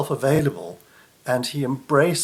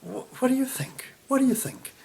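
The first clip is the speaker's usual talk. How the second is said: much higher, mock doubting whisper-then-voice; mock incredulous; 11 semitones higher than usual. Disciples doubting the small supplies, high mock disbelief. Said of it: "they're kind of like".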